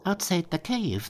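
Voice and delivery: high imp voice. A narrator's reading. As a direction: squeak it out nervously. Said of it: "they said".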